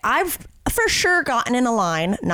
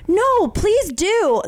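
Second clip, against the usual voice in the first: eager reassurance; 10 semitones higher, much slower than usual. Retold or imitated. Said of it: "And then I'm like".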